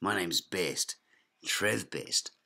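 ordinary talk

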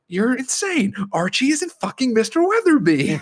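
high, exasperated squawk; hammed-up indignant outburst; mock outraged arguing. Acts out heated bar argument.

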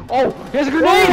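high, loud warning yell; alarmed shout. Real, not acted.

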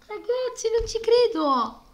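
high, loud, slow shout of disbelief; excited shout. Real, not acted. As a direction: yell it in total disbelief. Disbelief at a game find.

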